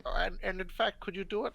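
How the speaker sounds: ordinary delivery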